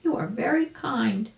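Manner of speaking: high, light mother bird voice; a lilting bird mother, thanking; mama bird voice. A narrator's reading. Mama bird thanks Uncle Wiggily, in a high lilting voice. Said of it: "said the mama bird".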